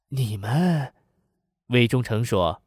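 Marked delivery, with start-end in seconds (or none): 0.1-0.9 put-on surprised old man. A narrator's reading. Old man to unexpected visitors in his house, surprised. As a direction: ask it in surprise, as an old villager. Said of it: "老汉惊讶地问".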